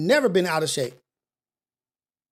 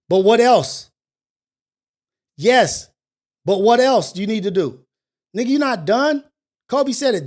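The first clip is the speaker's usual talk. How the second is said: a louder, pushing challenge to yourself; self-confrontation; 3 semitones higher, 3 dB louder than usual. Retold or imitated. Man calling himself out in the mirror, loud and pushing. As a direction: call yourself out with force.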